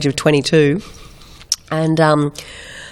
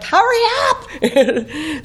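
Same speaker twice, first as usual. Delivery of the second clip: much higher, very slow laughing call; laughing plea; 12 semitones higher, much slower than usual. Retold or imitated. Stuck in the flooding water, pleading to hurry, laughing. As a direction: drag it out through a laugh. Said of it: "I'm like".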